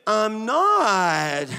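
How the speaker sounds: exaggerated whine with a swooping middle; hammed-up whiny denial; child's whining. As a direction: mimic a small child's drawn-out protest. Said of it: "I heard myself doing this".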